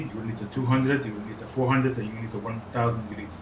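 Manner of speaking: ordinary talk, nothing marked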